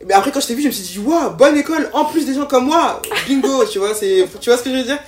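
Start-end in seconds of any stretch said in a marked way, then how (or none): 1.1-3.6 excited. Retold or imitated. Excited inner thought, retold.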